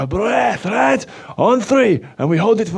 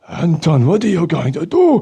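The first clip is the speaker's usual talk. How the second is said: ordinary talk, nothing marked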